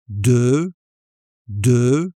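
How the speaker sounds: deux said slowly, repeated; careful deux. Shown as a demonstration. Teacher drilling learners on deux. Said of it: "This one is a nasal sound".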